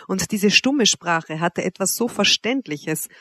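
ordinary delivery

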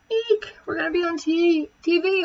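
put-on high squealing girl voice; girlish squeal. A narrator's reading. Girls squeal about being on TV, high and excited. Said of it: "girls started screaming".

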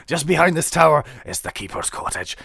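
Scottish NPC reading the quest; rolled Scottish burr for the guard captain; Scottish accent. Acted. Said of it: "He's Scottish as well".

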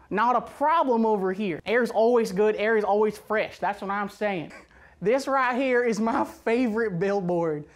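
mock Southern accent presenting slides; extreme Southern accent. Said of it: "in an extreme Southern accent".

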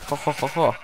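ordinary talk, nothing marked

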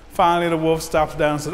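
ordinary delivery

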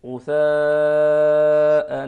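غثاء, slow and loud; transfer reading. Shown as a demonstration. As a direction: stretch غثاء, full voice. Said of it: "بهذا الشكل".

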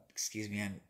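fighting off a sneeze mid-sentence; holding back a sneeze. Real, not acted. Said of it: "excuse me, I'm trying not to sneeze".

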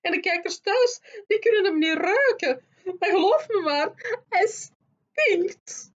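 loud, high-pitched ranting in character; crying out. A narrator's reading. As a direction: hurl out each disgusted accusation, high and overwrought. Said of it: "riep ze uiteindelijk". (luid en schril uitvarend, vol afkeer)

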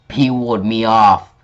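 usual speaking voice

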